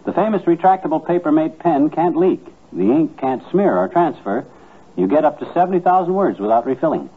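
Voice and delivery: upbeat sponsor pitch to the listeners; ad read. Acted. Husband and wife pitch Papermate pens to listeners, upbeat ad read. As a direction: sell the pen brightly, like a radio commercial.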